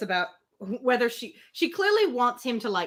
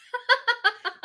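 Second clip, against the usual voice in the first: short bright burst of laughter; real laugh; 13 semitones higher, 5 dB louder than usual. Real, not acted. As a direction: laugh out at the line. Laughs at Emma's reasoning.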